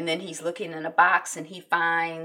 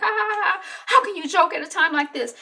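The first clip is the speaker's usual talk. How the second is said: mock laugh; 12 semitones higher, 5 dB louder than usual. Mock laugh, then reproach to husband.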